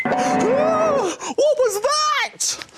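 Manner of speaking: high gasping slow fright shout; hammed-up scared gasp, very high; fake fright. Strange noise in dark.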